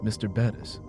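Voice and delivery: usual speaking voice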